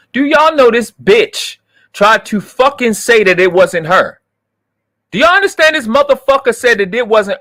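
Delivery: venting real fury at her lies; furious rant. Real, not acted. Streamer furious at chat about the girl's lie. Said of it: "I'm so fucking angry".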